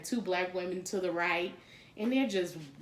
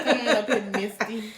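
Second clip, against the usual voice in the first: louder teasing call across the class; mock coaxing friend; 3 semitones higher, 9 dB louder than usual. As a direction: call her up front, playfully.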